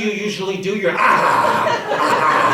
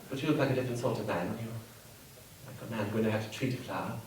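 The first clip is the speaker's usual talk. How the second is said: much softer, breathy come-on; seductive; 7 semitones lower, 11 dB quieter than usual. Acted. Hostess softly flirts with the Governor, much softer. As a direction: hush it like an intimate invitation.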